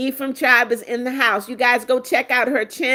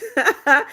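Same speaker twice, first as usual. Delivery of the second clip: high, happy laugh; pleased, higher-pitched chuckle; delighted laugh; 4 semitones higher than usual. Real, not acted. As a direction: laugh with delight after the thanks. Delighted thanks to a subscriber.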